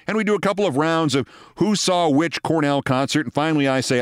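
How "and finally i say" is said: ordinary delivery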